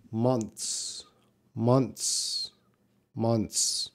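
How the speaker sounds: "Months" with a very slight TH before the S; barely touch the TH in "Months"; reduced TH. Shown as a demonstration. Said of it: "This T H sound is very reduced".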